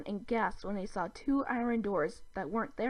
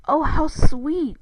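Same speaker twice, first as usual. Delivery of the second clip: ordinary delivery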